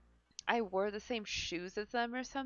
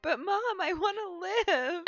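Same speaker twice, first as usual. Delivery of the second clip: higher, mock-tearful whine; dramatic pleading kid; 9 semitones higher, 5 dB louder than usual. Ginger begging her mom not to eat Carl's cooking, mock-crying whine. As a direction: plead like a melodramatic kid facing awful food. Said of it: "that was so funny".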